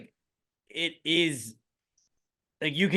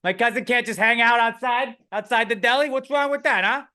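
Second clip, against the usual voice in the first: higher, indignant Italian tough; mock wiseguy defense; 7 semitones higher, faster than usual. Italian guy defends his cousin at the deli, mock indignant. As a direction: shrug off the cops' suspicion.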